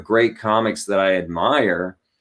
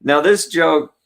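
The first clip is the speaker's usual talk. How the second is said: ordinary delivery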